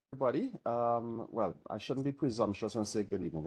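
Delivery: normal talking voice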